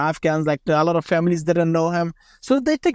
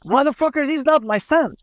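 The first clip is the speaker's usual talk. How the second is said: higher-pitched mock blast; indignant curse and denial, pitched up; retold angry outburst; 7 semitones higher than usual. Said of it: "he blasts".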